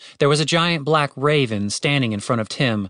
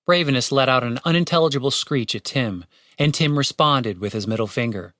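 plain reading voice

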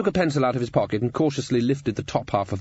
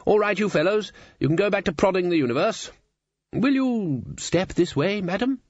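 higher-pitched, expansive chancellor, rolling on cheerfully; hearty old wizard chancellor; 9 semitones higher than usual. Acted. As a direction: chat grandly as the head wizard. Voices Ridcully in the story.

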